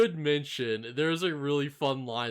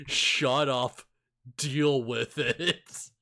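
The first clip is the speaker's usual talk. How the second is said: louder put-down, dragged out at the end; loud, slow-finishing imitation of a scolding; mock exasperated rebuke; 5 dB louder, much slower than usual. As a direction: cut him off firmly, stretch the last words. Acted Obi-Wan scolding Anakin.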